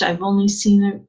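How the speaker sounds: ordinary delivery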